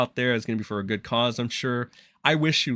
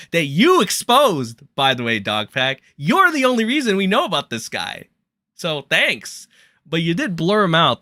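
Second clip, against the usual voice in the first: heated outburst; 6 semitones higher, 6 dB louder than usual. Real, not acted. Streamer calling out Dog Pack, angry and loud. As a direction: voice jumps up high and loud in anger.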